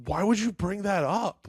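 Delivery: high, slow mock-indignant rebuke; scolding. Classmates turning on a boy, high mock scolding. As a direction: imitate indignant kids, stretched and high.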